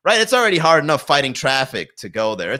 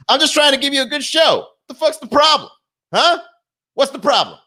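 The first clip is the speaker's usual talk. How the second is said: much higher, louder, hammed-up defensive snap; mock aggressive outburst; 13 semitones higher, 4 dB louder than usual. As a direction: play it like a touchy comic snapping at the crowd.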